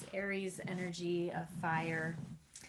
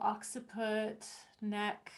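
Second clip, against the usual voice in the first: usual speaking voice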